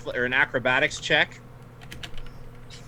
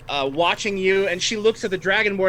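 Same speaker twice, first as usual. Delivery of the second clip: normal talking voice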